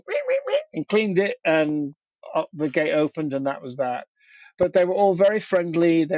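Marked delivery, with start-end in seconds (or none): 0.0-0.6 high squeaky meep meep; startled meep. Retold or imitated. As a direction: chirp it like a little beeper. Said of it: "he just went".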